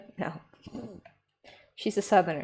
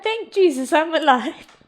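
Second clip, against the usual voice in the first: mock pious relief; 5 semitones higher than usual. Voicing pious relief, hammed up.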